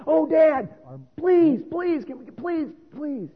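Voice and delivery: put-on high, eager begging kid; pleading child. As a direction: beg like a little boy at the toy shelf. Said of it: "They, they begged".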